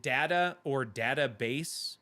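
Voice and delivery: "data or database" as dat-uh or dat-uh-base; short-A data. Shown as a demonstration. Streamer asking viewers who says it so, short-A data. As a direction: say both "data" and "database" with the A of cat. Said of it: "Does anyone pronounce it".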